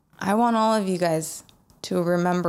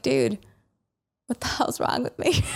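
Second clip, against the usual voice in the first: laughing at herself, half whispered; exasperated laugh through the words; self-mocking laugh to a friend; 12 semitones higher than usual. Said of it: "I was like".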